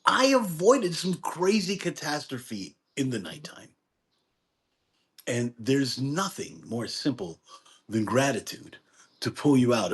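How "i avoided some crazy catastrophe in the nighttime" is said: bursting high on Hey; hammed-up relief at surviving; mock relief. Mock thanks for surviving the night, high excited burst. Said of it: "kinda like saying".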